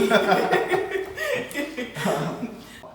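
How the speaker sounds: high burst of real laughter; loud laugh. Real, not acted. Big laugh at tease.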